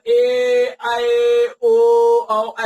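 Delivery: ए, ऐ, ओ, औ each held long; long vowels. Shown as a demonstration. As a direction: chant out ए, ऐ, ओ, औ slowly, one by one. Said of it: "उन सब में ज्यादा time लग रहा है".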